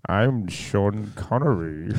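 put-on slow Scottish old man voice; Sean Connery. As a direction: drawl it like old Bond. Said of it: "Every old man voice I do is the same".

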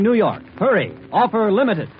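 ordinary talk, nothing marked

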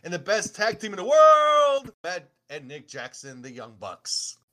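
booming ring announcer introduction; grand entrance announcement. Acted. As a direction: hype the crowd like an announcer.

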